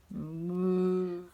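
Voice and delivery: long, droning moo; cow moo. Acted. Cow answering the hen, mooing.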